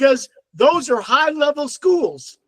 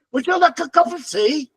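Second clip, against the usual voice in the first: higher-pitched imitation British accent; 4 semitones higher than usual. Imitating cousins offering a drink, mock British accent. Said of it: "they're speaking British".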